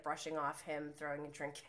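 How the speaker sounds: ordinary delivery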